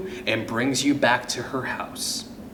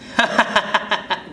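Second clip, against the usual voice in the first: high ghostly cackle; mocking laugh; 7 semitones higher than usual. Ghost John pointing at the stuck kid, mocking laugh. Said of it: "laughing and pointing at you".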